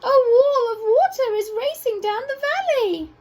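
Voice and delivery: high squeaky voice raising the alarm; squeaky giraffe. A narrator's reading. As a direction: squeak out the warning like a startled giraffe. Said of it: "in a surprisingly squeaky voice".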